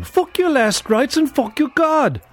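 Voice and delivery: high, strained outburst of rage; furious snap. A narrator's reading. Captive rejecting the priest's last rites, furious snap. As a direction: snap back, voice pitched right up. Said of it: "Lerwick snapped".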